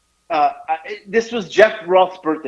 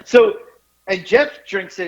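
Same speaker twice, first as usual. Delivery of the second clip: ordinary talk, nothing marked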